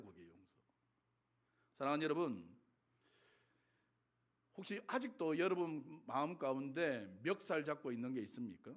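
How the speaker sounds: usual speaking voice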